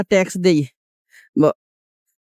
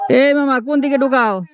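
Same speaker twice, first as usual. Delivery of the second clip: raised, high angry call; shouting at a driver; 6 semitones higher than usual. Real, not acted. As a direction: yell across the traffic.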